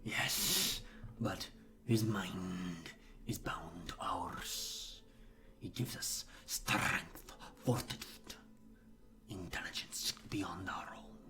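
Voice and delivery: slow, hushed, hissing creature voice; whispering hive creature. Acted. Creature tells of its many kin, slow eerie whisper. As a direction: drag the words out in an eerie half-whisper.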